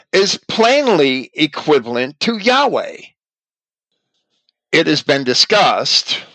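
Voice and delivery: usual speaking voice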